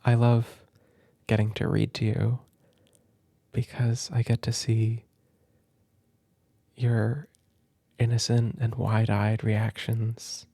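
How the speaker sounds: soft, slow sincere words; tender. Real, not acted. Parent telling the child why they love reading together, soft and tender.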